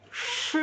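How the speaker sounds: "sh" hissed long with tongue on the palate; retroflex SH. Shown as a demonstration. Teacher showing how SH is said, retroflex. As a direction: curl the tongue up for a drawn "sh". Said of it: "ngomong kayak gini".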